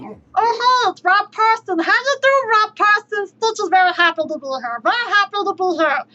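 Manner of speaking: high, loud cartoon alien voice; squeaky excited Stitch greeting; hammed-up Stitch voice; Stitch impression. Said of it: "Just doing my best Stitch impression".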